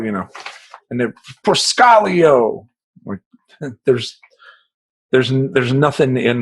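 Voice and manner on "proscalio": Proscalio called slowly, high and loud; guard butchering the name Proscalio; mangled surname. Retold or imitated. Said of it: "he couldn't say the last name Procopio".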